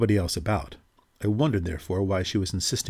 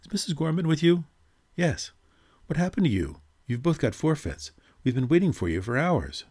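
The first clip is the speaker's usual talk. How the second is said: raised, higher calling voice; 8 semitones higher than usual. A narrator's reading. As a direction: call across the room. Reggie calling from outside curtain.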